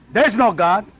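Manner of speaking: retold heckler, raising the volume; insistent heckle. Ignored heckler tries again.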